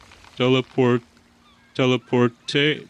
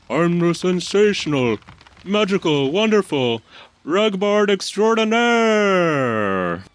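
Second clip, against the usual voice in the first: grand self-introduction; 6 semitones higher than usual. Acted. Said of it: "Branding is important".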